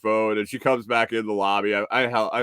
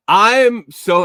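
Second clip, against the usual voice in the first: put-on loud old lady voice; 9 semitones higher, 11 dB louder than usual. Old woman quoted, loud.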